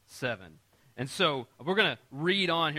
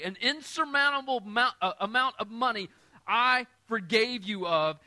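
louder and higher, pressing the point home; raised preaching voice; forceful retelling; 5 semitones higher, 6 dB louder than usual. Shown as a demonstration. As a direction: preach it hard, rising and pushing. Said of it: "And so he says".

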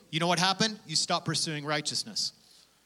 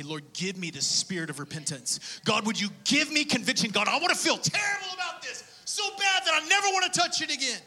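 pleading cry to God; 7 semitones higher than usual. Pretend. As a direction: voice climbing to a desperate high shout. Acted desperate prayer to God.

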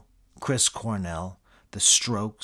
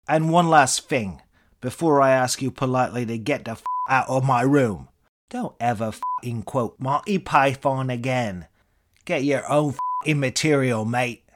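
put-on British accent, higher and much louder, scornful; scornful Eric Idle; 4 semitones higher, 6 dB louder than usual. British accent, the celebrity lecturing the room service guy, scornful. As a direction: sneer it in a Cambridge-tinged British voice. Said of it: "your British accent".

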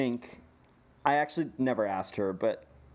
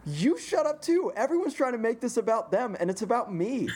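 mock yell; 11 semitones higher than usual. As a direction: bark it at the crowd, laughing through. Comedian yelling at the crowd.

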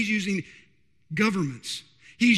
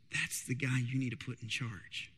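low, hushed divine voice, much softer; voice of God; 8 semitones lower, 11 dB quieter than usual. Acted. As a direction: speak as God, quiet and low. God speaks in Joseph story.

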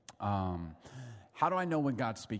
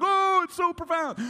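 much higher, sing-song gushing; mock awe; 6 dB louder than usual. Preacher mocking a profound moment, high mock awe. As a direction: gush in mock wonder.

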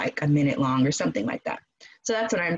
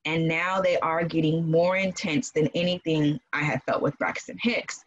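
normal talking voice

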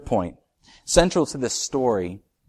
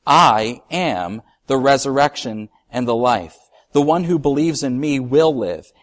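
scripture reading; 6 dB louder than usual. Acted. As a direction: proclaim it to the congregation. Reads Jesus' words aloud.

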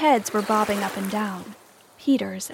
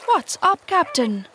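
lightly voiced pirate, starting high; questioning pirate; 6 semitones higher, 3 dB louder, slower than usual. A narrator's reading. Pirate asking the brooding captain, puzzled. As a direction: open high, then drop on the title.

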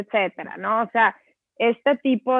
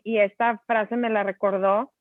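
ordinary delivery

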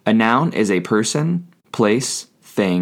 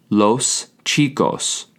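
slowly and clearly: los chicos; slow model pronunciation; slower than usual. Shown as a demonstration. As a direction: spell out "los chicos" syllable by syllable for the learners. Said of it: "you say".

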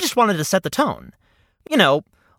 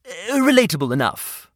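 ordinary talk, nothing marked

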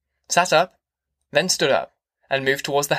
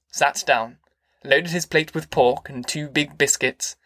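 plain reading voice

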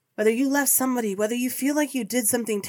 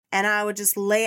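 usual speaking voice

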